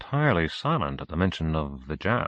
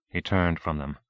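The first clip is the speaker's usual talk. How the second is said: plain reading voice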